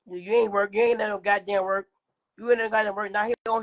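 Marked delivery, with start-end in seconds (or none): none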